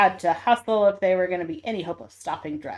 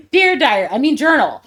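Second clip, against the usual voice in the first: loud, high cartoon villain; 4 semitones higher, 8 dB louder than usual. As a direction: ham up the evil villain. Voicing Doctor Nefarious diary.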